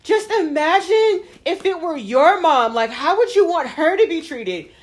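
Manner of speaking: imitating the neighbor. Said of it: "and then goes".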